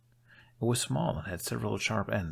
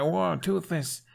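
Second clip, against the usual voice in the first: a drawn-out, high, louder startled whoa; 10 semitones higher, 4 dB louder, much slower than usual. A narrator's reading. Hiccup opening the door onto his dragon, startled and slow. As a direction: jump back as he appears in the doorway.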